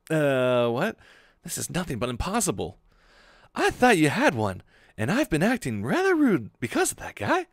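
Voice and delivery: plain reading voice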